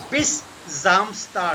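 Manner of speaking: Bis Samstag very slow and drawn out; careful teaching pace on Bis Samstag; slow model. Shown as a demonstration. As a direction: stretch Bis Samstag word by word. Teacher repeats it slowly for students.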